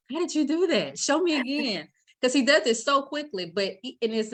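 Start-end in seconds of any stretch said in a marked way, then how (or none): 0.1-1.9 higher-pitched, amazed questioning; fascinated onlookers. Retold or imitated. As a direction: imitate wide-eyed, fascinated bystanders. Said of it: "everybody's always so fascinated".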